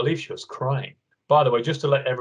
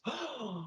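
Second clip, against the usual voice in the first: dramatic gasp; 11 semitones higher, 16 dB quieter than usual. Acted. Mock gasp.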